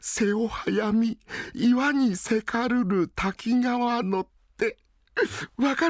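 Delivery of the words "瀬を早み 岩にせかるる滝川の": high, very slow recitation; poem recital. Acted. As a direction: chant the waka with feeling.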